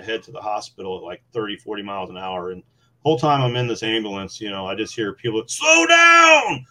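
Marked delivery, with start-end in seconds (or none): 5.5-6.7 high, loud shouted warning; mock yell. Bystanders yelling at the speeding ambulance, high loud shout. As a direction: bellow it like an angry bystander on base.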